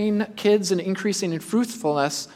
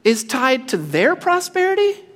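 incredulous; 5 semitones higher than usual. Retold or imitated. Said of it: "He said".

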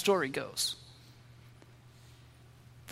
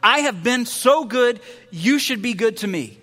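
entitled whine; 4 semitones higher, 5 dB louder than usual. Acted. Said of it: "the right to ask for and expect".

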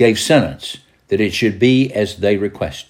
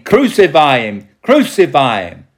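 higher, louder crowd chant; shouting; 4 semitones higher, 5 dB louder than usual. A narrator's reading. Crowd answering Pilate's plea, shouting. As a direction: shout it as a repeated demand. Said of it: "But they shouted".

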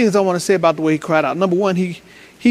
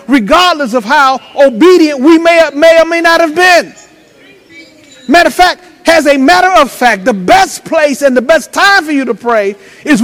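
raised, emphatic preacher delivery; fervent preaching; 6 semitones higher, 5 dB louder than usual. Acted. Preacher warns the congregation about the devil's lie, fervent preaching. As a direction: punch the key words to the congregation.

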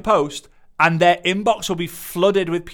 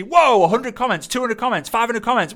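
impressed onlookers; 7 semitones higher than usual. Retold or imitated. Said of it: "People then see".

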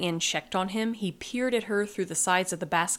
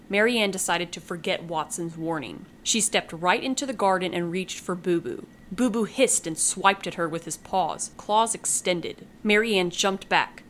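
ordinary delivery

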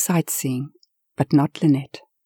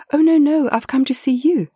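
eager protest; 9 semitones higher than usual. Acted. Eager visitor reassures.

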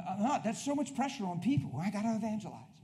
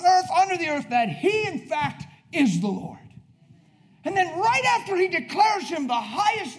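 rising, loud scriptural proclamation; full-force preaching; 9 semitones higher, 10 dB louder than usual. Real, not acted. Preacher proclaiming Christ exalted above every name, full-force preaching. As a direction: build to a high, loud declaration.

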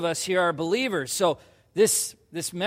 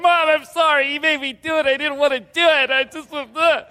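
high, loud teary pleading; blubbering apology like a caught teen; imitating crying; 7 semitones higher, 5 dB louder than usual. Teen crying to his mom, loud tearful plea. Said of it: "And I'm, like, crying".

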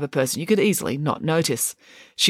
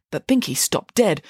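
usual speaking voice

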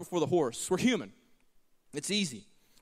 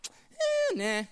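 mock dismissal. Mocking Egyptians' dismissive reply.

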